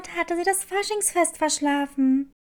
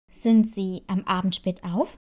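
lower, calm nurse asking gently; school nurse voice; 9 semitones lower than usual. Acted. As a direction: drop low and ask like a kind school nurse. Nurse questions Clara.

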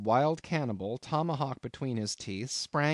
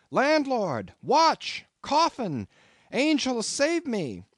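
much higher, much louder cry for help; panicked shout; 13 semitones higher, 6 dB louder, much slower than usual. A narrator's reading. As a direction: scream for the landlord in terror. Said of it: "shouted I".

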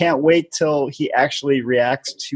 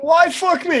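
high, squeaky mock outrage from the cameraman; offended cameraman. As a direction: play the cameraman, high and indignant. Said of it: "the cameraman's like".

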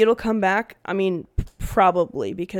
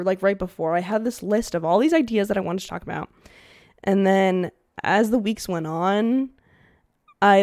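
ordinary talk, nothing marked

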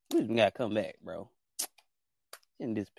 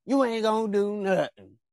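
taunting catchphrase; 7 semitones higher than usual. Retold or imitated. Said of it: "like me and Wisdom say".

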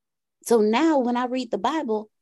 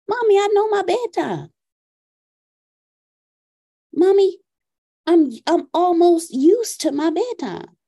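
imitating whiny son; 4 semitones higher, 6 dB louder than usual. Son pleading about bedtime.

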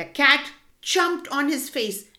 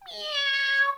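very high meow; cat meow. A narrator's reading. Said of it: "said the cat".

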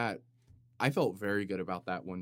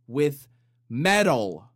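louder, blurted out; mock shock, louder; loud punchline; 4 semitones higher, 11 dB louder, slower than usual. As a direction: shout the last words. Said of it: "compressor on this microphone".

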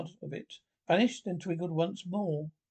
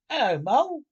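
high, loud friendly call; cheery Rat greeting; 8 semitones higher, 7 dB louder than usual. Acted. Rat calling out to Mole, high and loud. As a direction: Rat hails Mole brightly across the water.

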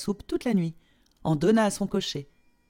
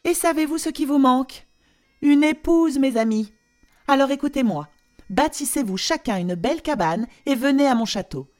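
higher, loud nagging lecture; scolding queen; 6 semitones higher, 5 dB louder than usual. Acted. Queen lists everything wrong with their cabin, loud and high. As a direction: rattle off the complaints grandly. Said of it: "elle conclut".

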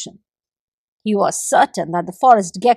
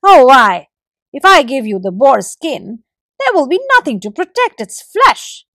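squirrel replying; 3 semitones higher, 7 dB louder than usual. A narrator's reading. Squirrel refuses the gecko, lightly voiced and louder. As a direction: object loudly to giving it away. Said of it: "replied the squirrel".

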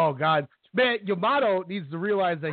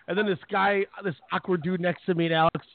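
ordinary delivery

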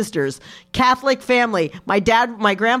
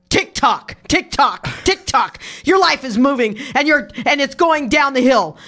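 loud, higher-pitched, punched tick-tocks; dad's harangue; 4 semitones higher, 4 dB louder than usual. Retold or imitated. Daughter acting out her dad scolding her in therapy, forceful harangue. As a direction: lecture your child forcefully, hammering each phrase.

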